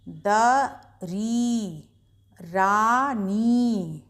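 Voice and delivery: slow, drawn-out word list for pupils to echo; slow read-along. Shown as a demonstration. Teacher leading children to read words aloud together, slowly. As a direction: read each word long and clear for them to repeat. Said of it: "ज़ोर ज़ोर से बोलकर पढ़ते रहिएगा".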